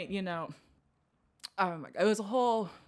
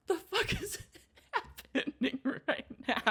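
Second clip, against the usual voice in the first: higher, slow laughing what-the-fuck; stunned at the hateful talk; laughing disbelief; 8 semitones higher, slower than usual. Retold or imitated. Teen hearing hateful remarks, laughing disbelief. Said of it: "I was like".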